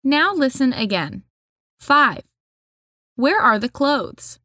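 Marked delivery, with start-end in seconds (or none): none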